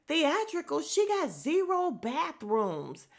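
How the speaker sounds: high, drawn-out mock outrage; mad, aggressive. Robin mocking the room complaint, imitated aggressive outrage. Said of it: "angry, and aggressive".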